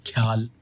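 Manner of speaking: ख्याल said as one syllable, khyal. Shown as a demonstration. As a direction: squeeze ख्याल into a single syllable. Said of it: "ख्याल ऐसे भी बोल सकते हैं".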